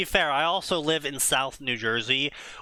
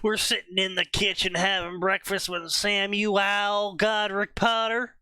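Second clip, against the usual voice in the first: high, slow Southern storytelling drawl; stretched-out Southern accent, pitched up; 6 semitones higher than usual. Acted. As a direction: read it out slowly in a put-on Southern voice. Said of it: "started using the southern accent".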